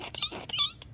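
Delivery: very high soft squeaks; mouse squeak. Pretend. Child playing mouse, high soft squeaks.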